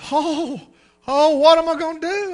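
high, loud anxious plea; distressed wail. Retold or imitated. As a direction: fret out loud. Distressed congregant wails.

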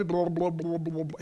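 babbled mock underwater burbling; imitated drowning gurgle. Preacher imitating Peter sinking mid-prayer, gurgling.

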